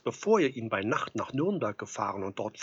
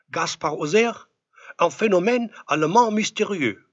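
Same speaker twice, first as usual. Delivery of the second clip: loud, raised-pitch headline cry; proclaimed French headline; 6 semitones higher, 8 dB louder than usual. A narrator's reading. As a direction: call out the French headline like breaking news. Reads out Paris newspaper headlines.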